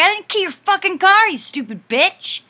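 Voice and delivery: full-throated furious yell, daring her back; enraged screaming challenge across the parking lot; freaking out. Retold or imitated. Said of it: "I was freaking out".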